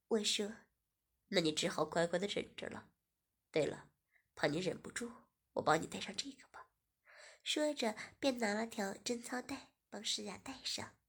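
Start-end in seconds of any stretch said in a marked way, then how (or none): 1.3-6.7 lower, smug man fading to a whisper; teasing male voice. Acted. Host telling Shiya to endure, lower. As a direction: go low and sly.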